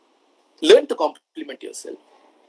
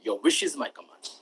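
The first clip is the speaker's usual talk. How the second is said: low, much softer genie voice; deep hushed genie answer; 8 semitones lower, 12 dB quieter than usual. Retold or imitated. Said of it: "Genie says".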